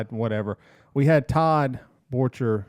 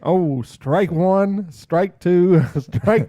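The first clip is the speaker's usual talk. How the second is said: loud, slow umpire calling strikes; 6 semitones higher, 6 dB louder, much slower than usual. Pretend. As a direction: bellow it like a baseball umpire. Umpire call about a strike.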